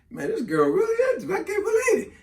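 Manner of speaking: high, laughing mock amazement; giddy disbelief. Man feeling special with her, giddy high disbelief. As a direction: squeal it, can't believe the luck.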